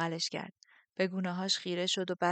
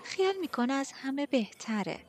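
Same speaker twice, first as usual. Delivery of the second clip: catty gossip; 7 semitones higher than usual. A narrator's reading. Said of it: "نادیا اضافه کرد".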